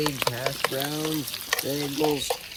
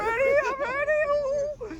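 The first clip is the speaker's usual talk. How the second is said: high drawn-out voice; 3 dB quieter, slower than usual. Pretend.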